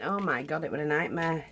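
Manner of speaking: ordinary talk, nothing marked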